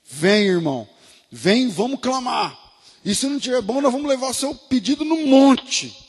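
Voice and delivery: mock Pentecostal preacher. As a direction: parody a miracle-crusade pastor calling the crowd. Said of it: "o culto do milagre urgente". (imitando pastor neopentecostal clamando, mais alto e agudo)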